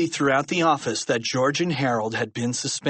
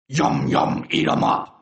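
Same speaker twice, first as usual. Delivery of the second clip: rough, unvoiced monster growl; throaty evil creature cry; evil toilet monster; 3 dB louder than usual. A narrator's reading. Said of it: "cried the evil toilet".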